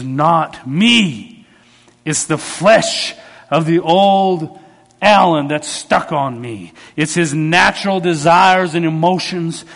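loud, emphatic pulpit delivery; forceful preaching. Real, not acted. Preacher urging believers to crucify natural emotions, loud and forceful. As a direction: drive each phrase home to the congregation.

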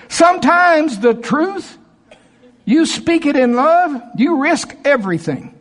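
high, slow, forceful cry; impassioned preaching. Real, not acted. Preacher urging truth spoken in love, impassioned cry. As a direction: drive each phrase home at high pitch.

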